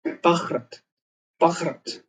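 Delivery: पखरत with p plus kh for bh; Russian spelling reading. Shown as a demonstration. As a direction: sound out पखरत as written in Russian. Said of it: "रूसी भाषा से लिखा जाता है".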